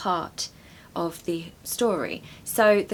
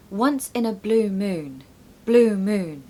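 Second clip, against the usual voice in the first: "blue" and "moon" said louder and stressed; 4 dB louder, slower than usual. Shown as a demonstration. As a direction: push the weight onto "blue moon". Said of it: "Make sure to stress blue and moon".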